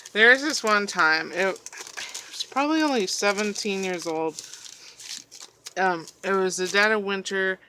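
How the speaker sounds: ordinary talk, nothing marked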